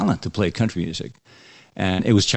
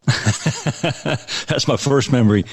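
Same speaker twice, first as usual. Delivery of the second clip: laughing; 5 semitones higher, much slower than usual. Real, not acted. Laughs recalling childhood bass attempt.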